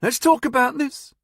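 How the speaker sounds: high, loud, rushed pleading; babbling. Acted. Nevin begging the gunman, babbling high and loud. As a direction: babble it out in panic. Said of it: "he babbled".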